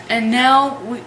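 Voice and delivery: ordinary talk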